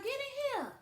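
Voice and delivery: imitating mom summoning her daughter; mom calling. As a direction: call her into the kitchen like mom. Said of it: "She'd be like".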